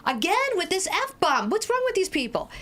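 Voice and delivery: high-pitched comic indignation; mock exasperation. Newcomer reacting to yet another swearing helper, mock exasperation. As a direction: pitch it way up, acting fed up.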